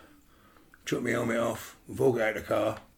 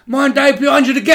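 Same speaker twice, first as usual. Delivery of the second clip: sudden yell from the car window, higher and louder; shouted warning; 12 semitones higher, 10 dB louder than usual. Retold or imitated. Mate yelling a warning out of the car window. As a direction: bellow the warning over the fight.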